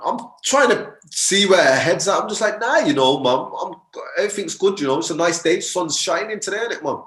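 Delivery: high, overly bright fake innocence; nervous innocence. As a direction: play it cool while panicking inside. Nervous boy playing innocent.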